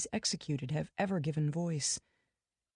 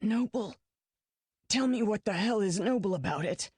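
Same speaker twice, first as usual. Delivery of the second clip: flash of anger; 4 semitones higher than usual. A narrator's reading. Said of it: "a small flame of anger".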